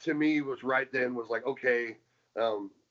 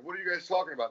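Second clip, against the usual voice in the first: bewildered; 7 semitones higher, 4 dB quieter, much faster than usual. Retold or imitated. Wrestler questioning the bosses before walking out, bewildered. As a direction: act out a puzzled question, pitch jumping up. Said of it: "I'm looking at him going".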